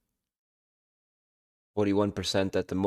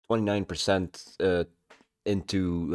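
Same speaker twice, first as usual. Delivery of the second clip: usual speaking voice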